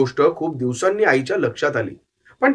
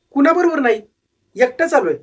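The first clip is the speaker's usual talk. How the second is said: put-on young runaway boy, higher voice, earnest; boy's voice; 9 semitones higher than usual. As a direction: plead your case to the old man. Boy says he came alone.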